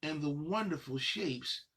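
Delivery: lilting rhyme reading, slowing on the last line; reading to children. Acted. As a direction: read the list of shapes to little kids, savoring it. Reads picture book aloud.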